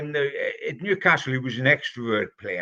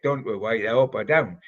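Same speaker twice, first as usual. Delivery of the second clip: ordinary talk, nothing marked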